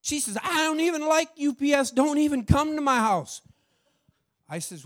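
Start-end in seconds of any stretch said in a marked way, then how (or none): 0.4-3.4 put-on high, louder grumpy old woman; ornery woman. Customer snapping at the delivery driver, cranky and loud. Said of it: "And she was ornery".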